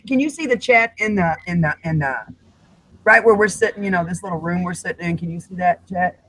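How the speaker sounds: ordinary talk, nothing marked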